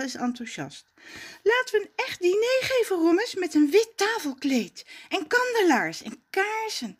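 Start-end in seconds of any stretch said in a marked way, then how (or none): 1.4-7.0 put-on higher, fast eager girl; excited Zaza. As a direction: bubble over with plans.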